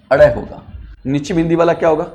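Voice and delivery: ordinary delivery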